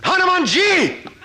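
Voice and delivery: very high, loud, drawn-out cry; retold scream. Master screaming as student rounds the corner, retold scream. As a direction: bellow the name at the top of your lungs. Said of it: "he screamed".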